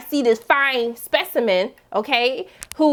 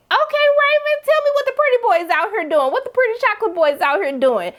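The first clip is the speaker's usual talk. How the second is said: much higher, playful hype; flirty teasing; 10 semitones higher than usual. Retold or imitated. Flirty reaction to a character.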